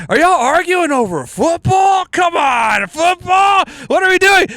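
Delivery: high, drawn-out mock outrage; over-the-top high-pitched hollering; making a scene. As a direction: cry out in exaggerated disbelief for all to hear. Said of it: "I'm making this big scene".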